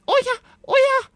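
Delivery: very high, fast, squawky little dragon; squawking voice. A narrator's reading. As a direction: squawk it eagerly like a tiny dragon. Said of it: "quäkte der kleine Drache".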